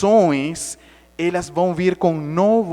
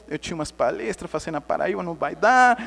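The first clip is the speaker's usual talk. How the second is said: ordinary talk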